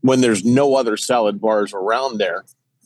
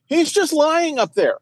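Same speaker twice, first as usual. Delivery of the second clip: high slow mock; 14 semitones higher, slower than usual. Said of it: "I'm like".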